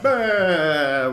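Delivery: mob roar. Acted. Mob roaring.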